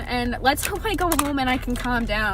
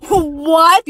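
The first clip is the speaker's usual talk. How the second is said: a louder, startled question; retold surprise; 3 semitones higher, 9 dB louder than usual. Recounting the news about the EpiPen, startled. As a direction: blurt it in mild mock disbelief.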